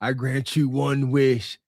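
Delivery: deep, slow dragon voice; grandly granting a wish. Acted. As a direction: boom it out like a great dragon. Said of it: "The Braka".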